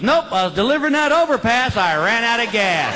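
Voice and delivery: high, deadpan sarcastic reply; sarcastic trucker. Retold or imitated. Trucker answers the patrolman, high sarcasm. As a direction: answer without missing a beat.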